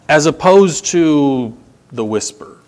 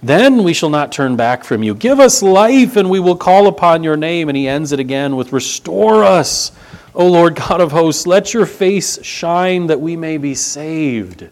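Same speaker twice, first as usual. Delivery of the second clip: louder, measured reading of the psalm, slowing at the end; scripture reading; 4 semitones higher, 4 dB louder than usual. Acted. Preacher reading Psalm eighty aloud to the church, solemn and raised. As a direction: proclaim the psalm to the congregation.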